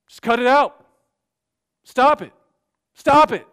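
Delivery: loud, high barked commands, building; mock shouted order. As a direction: hammer the pat advice like a preacher. Mimicking pastor's shouted counsel.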